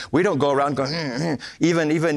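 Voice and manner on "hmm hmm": wordless grumbly hums, imitating a complainer; mock grumbling. Preacher mimicking people who complain, mock grumbling hums. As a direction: grunt a mock complaint without words. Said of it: "go around grumbling and complaining".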